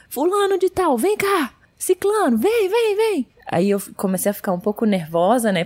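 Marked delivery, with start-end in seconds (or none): 0.1-3.3 high shouted calls across the airport; calling out. Retold or imitated. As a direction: yell for the others to come over. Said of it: "gritou todo mundo que tava ao redor".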